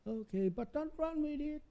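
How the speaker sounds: high-pitched scolding mom. Acted. Child and mom scissor skit.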